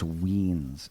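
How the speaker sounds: Weens said with a long ee, like beans; Weens, rhymes with beans. Shown as a demonstration. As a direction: say Weens, not Wines. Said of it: "it's W I E N S, but it's Weens".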